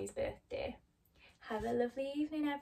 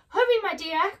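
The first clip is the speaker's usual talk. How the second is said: much higher and louder, calling out in alarm; urgent cry; 9 semitones higher, 11 dB louder than usual. Acted. Oz calling Dorothy as the balloon strains, crying out. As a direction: call her back before the balloon goes. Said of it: "cried Oz".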